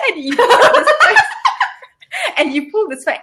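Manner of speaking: high, laughing through the words; giggling as she explains. Real, not acted. Still laughing after squeaky demo.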